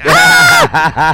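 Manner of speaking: high loud startled scream; shocked shriek. Retold or imitated. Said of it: "she was like".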